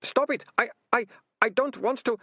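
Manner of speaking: high, distressed, stammering plea; tearful stutter. Acted. Ratcatcher resisting his confession, tearfully stammering. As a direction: plead in a high, breaking panic. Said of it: "Tears ran down his face".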